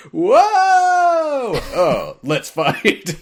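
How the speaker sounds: long high wail dropping into a challenge; comic falling shout. Retold or imitated. Giant gargoyle falls out of the sky at Sora, in a comic shout. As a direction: play a plummeting monster, hammed-up and loud.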